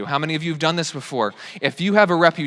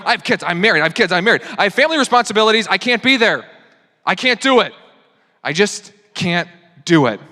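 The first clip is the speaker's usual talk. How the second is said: hammed-up excuse, higher and louder; mock protest; 5 semitones higher, 4 dB louder than usual. Preacher mocking churchgoers hiding behind family, hammed-up protest. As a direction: rattle off the excuses in mock outrage.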